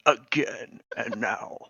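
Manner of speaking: lightly voiced mocking giant; slow, taunting giant threat; two bickering giant voices. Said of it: "and goes".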